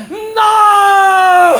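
high shouted no; desperate high yell of no; retold shout. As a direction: yell it to stop mom. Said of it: "yo desde acá gritándole".

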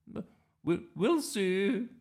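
high, loud, faltering grandma voice. Acted. Grandma putting off the dressing up question, high and faltering. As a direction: stammer it evasively as a dotty granny. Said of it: "but Grandma just said".

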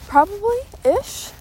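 high, drawn-out unsure guess; high uncertain. Real, not acted.

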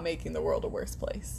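hushed whispered aside; whispering. Pretend. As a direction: whisper it like a secret.

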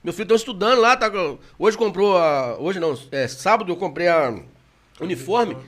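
ordinary delivery

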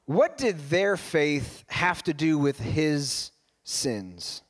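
slow pondering. Real, not acted. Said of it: "You know, I wonder".

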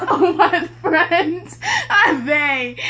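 wheezing out a mock complaint; laughing. Real, not acted. Mocking the friends, laughing hard.

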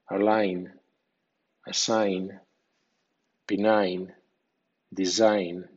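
each word ending in "ain", g silent; English pronunciation demo. Shown as a demonstration. Teacher lists words with silent g, careful demo pronunciation. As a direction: model align, assign, benign with a clear "ain" ending. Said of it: "intermedio o final suena ain".

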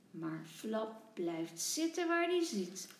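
ordinary delivery, nothing marked

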